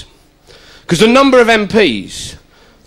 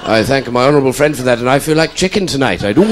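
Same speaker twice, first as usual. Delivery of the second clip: lower, pompous parliamentary question; MP in Commons; 7 semitones lower, faster than usual. Pretend. MP quoting an ad jingle in the Commons, pompous. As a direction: ask it like a solemn backbencher. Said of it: "sing the jingles".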